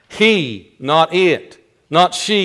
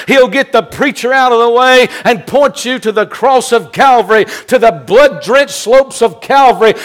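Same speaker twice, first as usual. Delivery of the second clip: higher and louder, building hard toward Calvary; full-force preaching; 5 semitones higher, 4 dB louder than usual. Acted. Preacher pointing the congregation to the cross, at full force. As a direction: preach it like the climax of the sermon.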